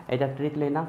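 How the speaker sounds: ordinary talk, nothing marked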